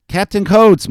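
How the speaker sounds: high, loud summons; shout into the air. A narrator's reading. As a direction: holler for the captain. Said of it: "Mowry shouted into the air".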